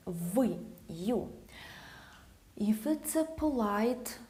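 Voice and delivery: normal talking voice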